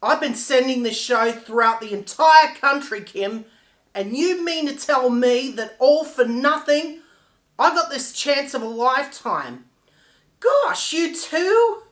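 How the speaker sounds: loud, indignant confrontation; angry outburst. Acted. Confronting Kim over a lost chance with the show, angry outburst. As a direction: lash out, pushing the key words hard.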